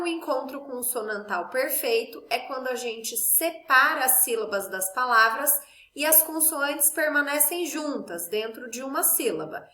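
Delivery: normal talking voice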